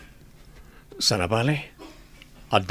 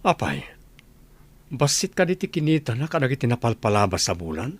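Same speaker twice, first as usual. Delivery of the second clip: ordinary delivery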